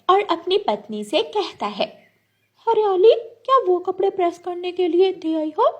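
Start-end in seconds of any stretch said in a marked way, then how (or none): 2.7-5.7 high, loud parrot husband voice; parrot husband, inquiring. Acted. Parrot asking his wife about the clothes, high and inquiring. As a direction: call to your wife in a raised, high voice.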